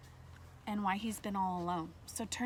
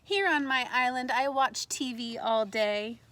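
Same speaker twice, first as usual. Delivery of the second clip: louder, slowed storybook telling; story reading; 2 semitones higher, 6 dB louder than usual. Acted. Teacher reading the boy's happy island day, bright read-aloud. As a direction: savour the boy's dream day.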